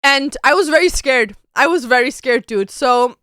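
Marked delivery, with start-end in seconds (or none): none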